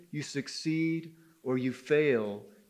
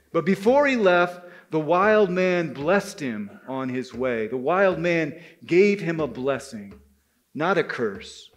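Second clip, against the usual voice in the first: ordinary delivery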